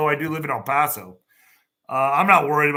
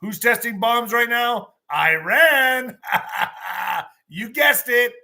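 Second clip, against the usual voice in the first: higher, slower teasing question to the audience; teasing quiz; 6 semitones higher, 4 dB louder, slower than usual. Real, not acted. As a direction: lead the crowd to the answer. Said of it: "quiz to audience".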